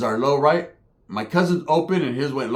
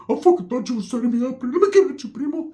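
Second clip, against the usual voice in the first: pitched way up, wound up; high keyed-up Ricky; 9 semitones higher than usual. Retold or imitated. Ricky confronting his cousin, high and wound up. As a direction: talk up high and jittery. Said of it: "Ricky's like".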